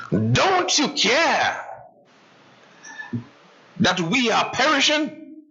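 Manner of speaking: high, pleading cry of panic; frightened disciples crying to their teacher; desperate cry for help. Acted. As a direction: plead like sailors sinking in a storm. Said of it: "they cried out in despair".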